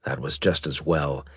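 ordinary delivery

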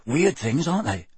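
ordinary delivery

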